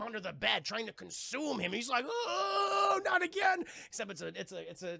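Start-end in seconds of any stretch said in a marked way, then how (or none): 2.1-3.6 high, loud cry of dismay; boy grabbed by the clown, crying out; mock terrified yell. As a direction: yell in a panic. Boy yells in terror.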